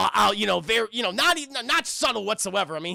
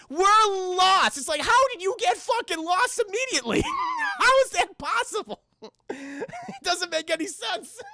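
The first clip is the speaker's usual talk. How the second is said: incredulous outburst; 10 semitones higher than usual. Real, not acted. Streamer yelling at the movie in disbelief. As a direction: shout it in mock outrage.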